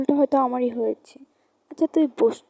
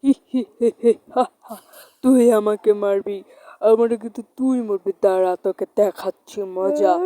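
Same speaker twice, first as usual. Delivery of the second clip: evil cackle into a lower, louder threat; menacing ghost; 4 semitones lower, 4 dB louder than usual. Acted. Ghost mocks the girl who would shoot it, menacing. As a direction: taunt like a vengeful spirit.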